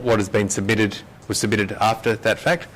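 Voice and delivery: ordinary talk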